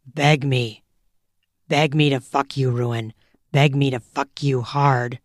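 low dominant command. Acted. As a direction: order her in a low voice. Dom commanding partner.

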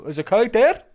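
a hammed-up high dad impression; mock dad voice. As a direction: squeak it out like a baffled dad. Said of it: "He go".

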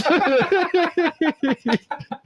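high, drawn-out laugh; long laugh. Real, not acted. Comic laughing at his own punchline, long high laugh. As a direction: crack up helplessly at your own joke.

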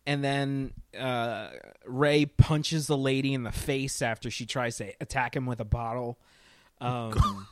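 usual speaking voice

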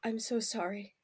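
soft, hushed, grieving apology; Ella whispering sorry at her friend's corpse; tearful whisper. Acted. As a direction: kneel and apologise through quiet tears. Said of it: "silently crying".

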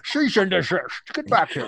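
comic slushy Scottish demand; Sean Connery. Acted. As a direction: call after someone in mock Scottish. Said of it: "Sean Connery in Scotland being like".

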